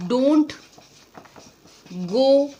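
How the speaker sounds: word by word with long pauses; slow dictation. Shown as a demonstration. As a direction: model it slowly for the class. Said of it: "अगर आपको ये statement बोलना हो". (रुक-रुककर धीरे बोलना)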